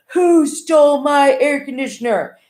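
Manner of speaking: slow, high, bellowing roar. A narrator's reading. Jordan bellowing at the household over his stolen air conditioner. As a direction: bellow it out across the apartment. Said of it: "heard him bellow".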